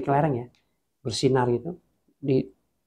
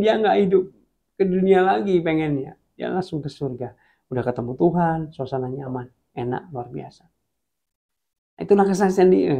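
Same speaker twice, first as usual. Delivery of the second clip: usual speaking voice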